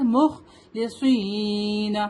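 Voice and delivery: مخلصین with a dark "s" and long "ee"; slow chant, stretching the end of مخلصین; heavy saad. Shown as a demonstration. Teacher shows saad is heavy, slow recitation. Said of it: "صاد is heavy letter".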